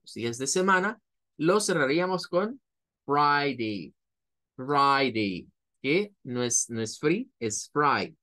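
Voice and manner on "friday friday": Friday said as FRAI-day, long I; FRAI-day, I sound stretched. Shown as a demonstration. Teacher modelling FRAI-day for students. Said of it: "suena a I".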